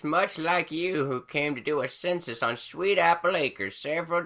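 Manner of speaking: put-on high old crone voice, reminiscing; high old granny reminiscing; fond old granny. A narrator's reading. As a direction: tell an old tale warmly. Granny Smith figure voice.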